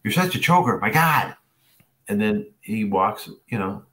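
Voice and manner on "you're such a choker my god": high, loud imitated scolding; exasperated put-down, pitched way up and loud; coach's mocking jab. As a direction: coach parroting a player's self-abuse. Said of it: "And he goes".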